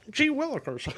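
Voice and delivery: squeaky cartoon attempt, high and unsure; Shaggy. Player warming up for a Scooby-Doo character, high goofy voice. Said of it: "I got Shaggy".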